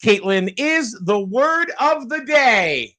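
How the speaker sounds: high, drawn-out announcer build; game-show big question. Real, not acted. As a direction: stretch out the big question dramatically. Host puts the big question.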